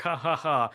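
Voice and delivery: spelled-out ha-ha-ha, put on; fake laugh. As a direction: mimic a corny end-of-movie chuckle. Mimics characters' laugh at ending.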